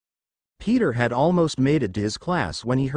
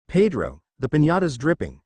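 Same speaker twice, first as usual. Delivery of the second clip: usual speaking voice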